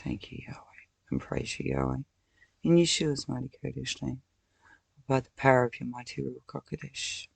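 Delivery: soft, quiet praying; hushed prayer. Real, not acted. Praying to Yahweh for forgiveness, hushed prayer. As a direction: pray under the breath.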